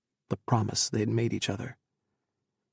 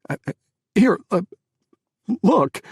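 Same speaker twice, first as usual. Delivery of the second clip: higher, louder, fumbling through tears; crying; 9 semitones higher, 10 dB louder than usual. Acted. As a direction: stammer it out sobbing. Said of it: "he was crying again".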